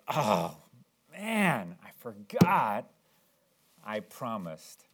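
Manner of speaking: slow, deflated mock dismay; acted sheepish regret; dismayed realization. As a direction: groan it out as it dawns on you, fading away. Acts out remembering a forgotten promise.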